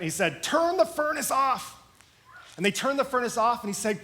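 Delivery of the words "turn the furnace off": urgent order. Acted. President orders furnace stopped.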